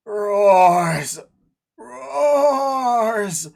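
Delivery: high shrieking monster cry; screeching creature. Acted. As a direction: screech it like a wild beast.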